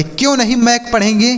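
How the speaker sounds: normal talking voice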